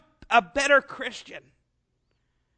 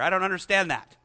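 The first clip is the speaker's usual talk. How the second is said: normal talking voice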